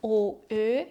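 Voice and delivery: O then Ö, lips rounded with the tongue pushed forward; O versus umlaut Ö. Shown as a demonstration. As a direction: contrast O with a fronted, rounded Ö. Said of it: "But schön".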